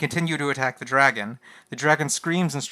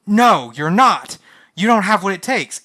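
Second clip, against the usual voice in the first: forceful denial; 5 semitones higher, 8 dB louder than usual. Acted. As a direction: shut him down hard. Knight's role voiced in argument.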